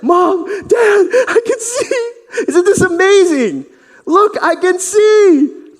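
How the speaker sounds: very high, loud cry of joy; tearful joyful shout. Acted. Healed son showing his parents he sees, crying with joy. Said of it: "he's like".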